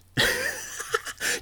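airy high-pitched giggle; high breathy laugh. Real, not acted. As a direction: let out a wheezy laugh. Laughs at own story.

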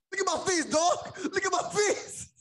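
high, half-laughing yell; angry demand. Retold or imitated. Tim yelling at Derek in the fight, high laughing shout. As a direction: shove it in his face, laughing through.

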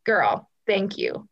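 ordinary delivery

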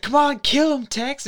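hammed-up high girlish voice; squeaky egging on. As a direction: falsetto mock cheerleader for murder. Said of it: "the girl behind him was like".

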